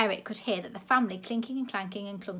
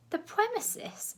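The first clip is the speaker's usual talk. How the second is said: slow, much higher mocking echo; 13 semitones higher, slower than usual. A narrator's reading. Grandma mocking the director's word, slow and high. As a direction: parrot his word back.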